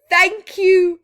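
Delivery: high, loud grateful call; bright excited thank you; happy thanks. Acted. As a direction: shout thanks as you wave goodbye. Wilf waving to friends.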